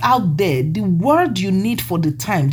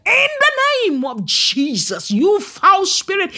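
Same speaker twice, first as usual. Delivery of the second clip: retold command; 11 semitones higher than usual. Man of God casting out a foul spirit, retold high commanding cry. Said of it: "the man commanded".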